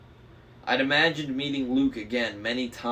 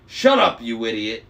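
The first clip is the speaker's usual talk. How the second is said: angry growl; 8 dB louder than usual. A narrator's reading. Agrias snarling at his laughing brother. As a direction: bark it at a dumb brother. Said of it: "Agrias growled".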